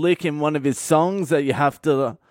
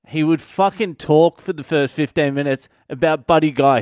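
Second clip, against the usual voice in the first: normal talking voice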